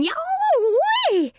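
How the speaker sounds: very high, drawn-out cartoon yowl; yelp of pain. Coyote's mouth on fire from chili, yelping. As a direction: howl as the burn hits. Coyote burned by hot sauce.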